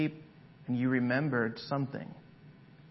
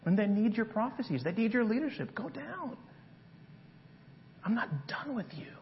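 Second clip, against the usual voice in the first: higher, soft, intimate plea; God gently calling Elijah back; 6 semitones higher, 4 dB quieter than usual. Acted. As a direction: speak close, urging him gently. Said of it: "He says".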